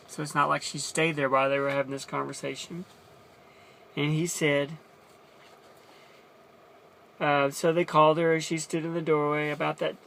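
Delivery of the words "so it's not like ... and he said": ordinary talk, nothing marked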